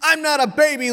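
high, loud acted child's protest; shouting. Youngest son denying the insult, shouting. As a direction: shout back at your big brother. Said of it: "Levi shouts".

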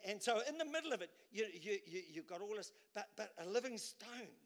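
usual speaking voice

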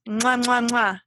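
three smacking air kisses; blown kisses. Real, not acted. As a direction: blow kisses to the listeners. Blowing kisses goodbye.

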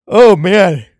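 cracking up in disbelief; laughing exclaim. Real, not acted. Laughing at the verse.

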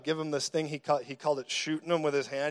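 ordinary talk, nothing marked

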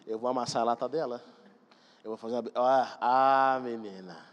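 low-pitched pent-up exasperation, imitated; mock threat kept under his breath; exasperated dad's thoughts. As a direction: play an embarrassed father grumbling inwardly. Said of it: "você pensa assim".

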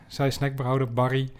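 usual speaking voice

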